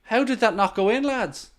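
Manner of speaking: high-pitched, stunned question; incredulous. Real, not acted. Streamer asks the lads about the missed chance, high incredulous voice.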